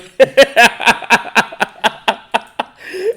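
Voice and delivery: loud high laugh bursting out; big burst of laughter. Real, not acted. Cracking up at the song's lyrics, loud burst of laughter. Reacting to absurd song lyrics.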